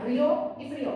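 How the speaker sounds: "río" and "frío" both stressed on the final o. Shown as a demonstration. Teacher showing how both sound without tildes, stress on O. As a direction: glide through the i in "río" and "frío" onto the o. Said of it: "Porque si no sonaría".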